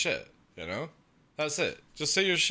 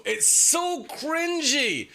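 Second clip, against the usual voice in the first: high, slow, drawn-out "so cringy"; exaggerated disgust; 11 semitones higher, much slower than usual. Real, not acted. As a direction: stretch out the cringe. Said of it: "exaggerated disgust".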